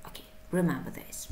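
fast whispered aside; quick whisper. Real, not acted. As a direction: slip it in softly and quickly, just under the breath. Teacher's quick aside to class.

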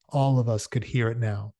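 ordinary delivery, nothing marked

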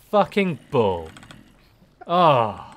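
ordinary talk

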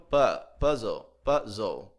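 "puzzle" said "pazo", U like open A, final LE like "ou"; teacher models "puzzle" as "pazo". Shown as a demonstration. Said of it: "O U aqui vai ter um pouco o som de A". ("puzzle" pronunciado "pazo", U com som de A)

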